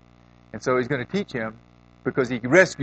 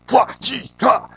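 a high, loud martial-arts yell; kiai shouts; 12 semitones higher, 8 dB louder, much slower than usual. Retold or imitated. Fifty boys training in kung fu, sharp battle yell. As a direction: shout it like a kung fu strike cry. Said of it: "they were like".

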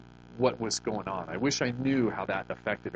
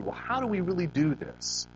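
ordinary delivery

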